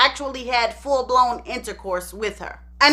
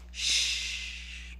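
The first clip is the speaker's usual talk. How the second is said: hushing mother; 9 semitones lower, 6 dB quieter, slower than usual. Retold or imitated. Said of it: "So Ederline goes on to say".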